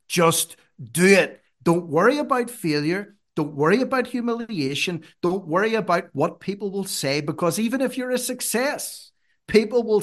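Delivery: preacher's push to act; rousing exhortation. Real, not acted. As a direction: urge them on with conviction.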